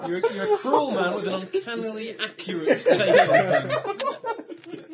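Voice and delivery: loud, high words through real laughter; helpless laughter. Real, not acted. Teasing the failed accent through laughter.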